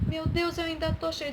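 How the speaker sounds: mild dismay, pitched high; discouraged. Acted. Naamã sees he is still covered in sores, mildly dismayed. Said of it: "Ele começou a desanimar".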